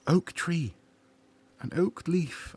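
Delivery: gasp, then a high, delighted oak tree; real wonder at spotting it; delighted surprise. Real, not acted. Exploring the game world, gasping in delighted surprise.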